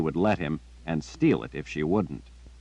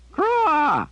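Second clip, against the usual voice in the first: high, loud piercing caw; raven caw; 5 dB louder than usual. A narrator's reading. Raven cawing to make Jillian follow. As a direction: shriek like a raven.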